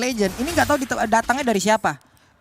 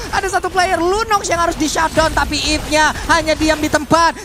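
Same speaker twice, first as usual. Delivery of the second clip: esports caster; 3 semitones higher, 4 dB louder than usual. Real, not acted. Live play-by-play of final fight.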